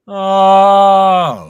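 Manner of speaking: full-throated high scream; warm-up scream. Shown as a demonstration. Voice actor demoing a scream warm-up, long loud scream. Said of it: "just kinda scream loudly".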